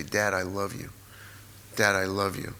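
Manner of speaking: low, soft declaration of love; quiet, earnest and low; sincere affection. Retold or imitated. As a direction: say it tenderly to a father. Retold telling father of love.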